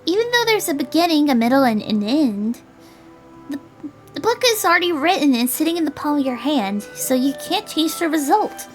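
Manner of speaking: put-on high girlish character voice; high, earnest young storyteller; explaining girl. Voices Julie explaining her theory.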